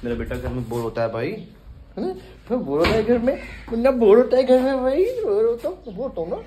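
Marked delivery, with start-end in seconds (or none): 2.0-6.4 put-on high, loud voice for the bored child. Uncle voicing his bored nephew in a high whine. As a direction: speak for the little one, whining high.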